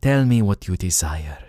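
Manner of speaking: slow, low-key exotic merchant voice; unhurried desert trader offering treasures; mysterious desert merchant. Acted. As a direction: lure the customer in, slowly and softly.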